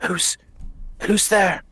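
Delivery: a lightly gruff prisoner calling out. Acted. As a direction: call out warily from the dark, gruffly. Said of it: "you hear a gruff voice ask".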